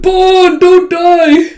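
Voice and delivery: very high, loud alarmed yell; frantic child's shout; panicked cry. Retold or imitated. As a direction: scream for the fallen dog. Dog hit in face.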